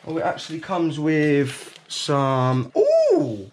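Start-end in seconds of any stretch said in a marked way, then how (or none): 2.8-3.5 sudden high squeal of surprise; a delighted high ooh; surprised ooh. Real, not acted. Surprised at recipe inside.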